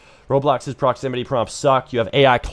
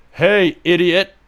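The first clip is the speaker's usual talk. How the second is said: mock taunt; 4 semitones higher, 5 dB louder, slower than usual. Taunting the AI NPC.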